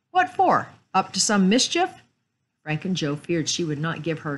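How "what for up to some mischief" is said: high, loud feminine challenge; high-pitched suspicious sister; suspicious woman. A narrator's reading. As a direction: question them sharply in a raised lady's voice. Suspicious sister questions the boys.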